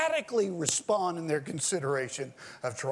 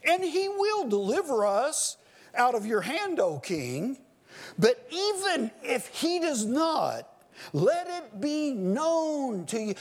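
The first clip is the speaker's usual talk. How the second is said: high, ringing preacher's read of Daniel; fervent scripture reading; 9 semitones higher than usual. Acted. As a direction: proclaim the text as if from the pulpit.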